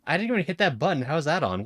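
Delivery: high squeaky voice effect; chipmunk voice. Real, not acted. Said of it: "started becoming a chipmunk voice".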